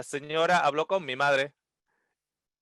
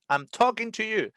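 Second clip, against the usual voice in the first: mock Australian; 8 semitones higher than usual. Customer leans in again.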